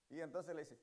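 normal talking voice

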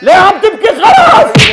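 high, exasperated phone yell; loud yelling. Acted. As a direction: yell at someone to stop crying. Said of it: "وطي صوتك".

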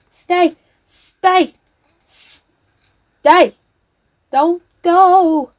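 high, loud, begging repeats; calling after a leaving guest, loudly begging; loud pleading. Real, not acted. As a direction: plead with him to stay. Begging her Sim not to leave.

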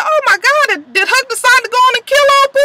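high, loud, fast alarm; shocked gasp. Retold or imitated. Reacting to Huck's needle, high shocked outburst. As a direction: blurt it out in disbelief. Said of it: "I said".

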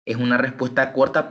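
ordinary delivery

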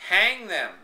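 loud unified cry for hanging; crowd crying out. A narrator's reading. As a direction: call it out like a mob. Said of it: "the people cried as one".

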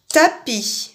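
ordinary talk

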